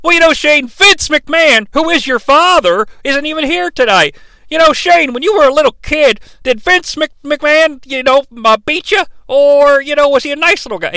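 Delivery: high-pitched, needling commentator voice; mock Cornette. Cornette needling Shane about his dad, high mocking voice. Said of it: "he keeps saying things like".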